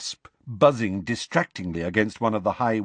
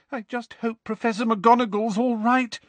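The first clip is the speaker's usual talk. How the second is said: tearful; 12 semitones higher than usual. A narrator's reading. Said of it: "said Lavender tearfully".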